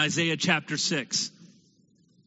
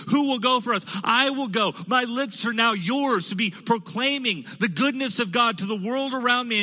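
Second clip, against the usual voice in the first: higher, louder, eager cry; eager volunteering; 6 semitones higher, 3 dB louder than usual. Acted. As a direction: jump at the chance to serve. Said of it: "He says".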